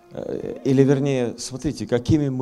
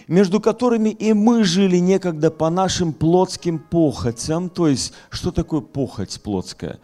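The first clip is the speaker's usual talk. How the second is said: raised, forceful pulpit voice; fiery preaching; 9 semitones higher, 6 dB louder than usual. Acted. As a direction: preach it hard to the hall.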